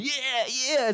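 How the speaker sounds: mock cheering crowd. Homeless fighters cheering.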